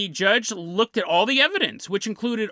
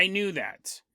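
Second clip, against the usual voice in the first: softer, a bit embarrassed; sheepish admission; 6 dB quieter than usual. Retold or imitated. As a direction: mumble it like caught out. Captain admits knowing, sheepish.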